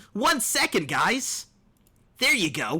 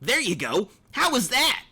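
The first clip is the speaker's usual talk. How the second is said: ordinary delivery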